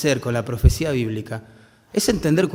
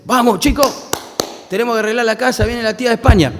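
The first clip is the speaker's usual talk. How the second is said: put-on high, loud hurried call to the kids; rally the kids in a panic, the aunt is coming; frantic parent; 9 semitones higher, 5 dB louder than usual. Rushing kids to tidy house.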